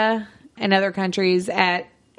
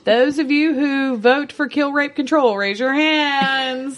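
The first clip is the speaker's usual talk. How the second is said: meeting chair; 6 semitones higher, 4 dB louder than usual. Pretend. Mock gang meeting vote.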